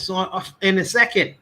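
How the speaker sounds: normal talking voice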